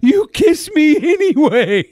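high, slow girlish mimic, laughing; mocking girl voice. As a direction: mimic her, giggling. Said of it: "She says".

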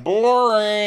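high, loud jeer; mock heckle. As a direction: jeer like a bored crowd. Heckling crowd voiced in retelling.

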